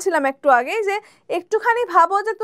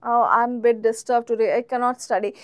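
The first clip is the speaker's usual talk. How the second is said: slow, lower reply to yourself; slow, low practice reply; self-talk answer; 5 semitones lower, much slower than usual. Shown as a demonstration. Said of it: "Then তুমি জিজ্ঞেস করতে পারো".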